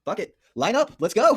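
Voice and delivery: high, loud call to charge; rally the riders like a king; mock rallying shout. Acts Theoden rallying his riders.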